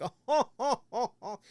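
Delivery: high, loud put-on newsman chuckle; fake anchor laugh. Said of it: "Sometimes he comes off a little fake".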